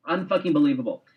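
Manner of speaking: ordinary delivery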